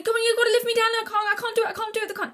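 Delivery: put-on high, loud whiny child wailing; imitating her crying child self. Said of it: "and they'd say".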